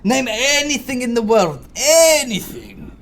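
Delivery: high, loud bragging; boastful Greeks. Retold or imitated. Mocking Greeks who claim every invention, loud high brag. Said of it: "they love to claim that one".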